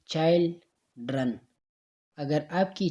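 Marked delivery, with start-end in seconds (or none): 0.1-1.3 put-on slow "children" in two beats, chil and dren; split into syllables. Shown as a demonstration. Teacher demonstrating hand-under-chin trick, "children" syllable by syllable. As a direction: leave a clear pause before the "dren" of "children". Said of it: "किसी word को बोलना है".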